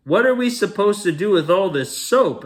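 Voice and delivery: high, loud exasperated question; indignant question. Acted. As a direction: demand it in disbelief. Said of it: "asked the terrible".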